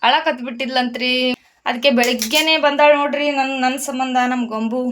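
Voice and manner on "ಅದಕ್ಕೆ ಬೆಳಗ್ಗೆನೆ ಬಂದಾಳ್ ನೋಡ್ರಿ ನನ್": ordinary talk, nothing marked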